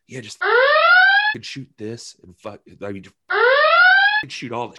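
slow, stretched, swinging voice; hammed-up impression of a carefree boss. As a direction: drawl it out with no care. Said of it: "just like".

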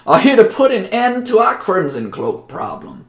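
mayor welcoming the strangers, high and loud. Acted. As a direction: play the town mayor, pitched high and eager. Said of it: "the mayor says".

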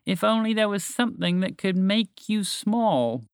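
high, slow mock wistfulness; drawn-out, pointed sarcastic hint. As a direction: lay the sarcasm on slowly. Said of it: "she said sarcastically".

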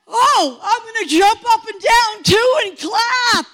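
high, loud, eager exclamation; excited imitation. New convert copying her mother's friends, excited exclamation. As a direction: gleefully catch on and join in. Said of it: "And I'm like".

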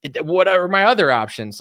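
normal talking voice

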